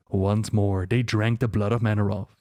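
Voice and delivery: plain reading voice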